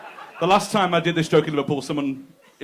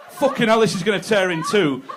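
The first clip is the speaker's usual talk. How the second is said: mock panic; 5 semitones higher, 6 dB louder than usual. Man panicking.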